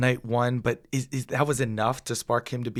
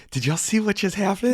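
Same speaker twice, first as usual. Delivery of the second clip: higher-pitched imitation of an excited dad; mock astonishment, pitched up; 8 semitones higher than usual. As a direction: burst out with a retold, eager question. Retells dad bursting out excitedly.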